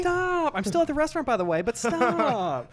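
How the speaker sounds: hammed-up diner's whine at full volume; mock exasperated shouting. Acted overdramatic diner on the phone.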